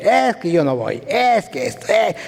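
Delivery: whiny imitation of a complainer; mock complaining. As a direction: drag out the excuses in a put-on grumble. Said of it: "puras quejas".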